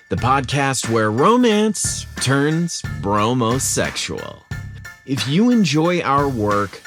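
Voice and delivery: ordinary delivery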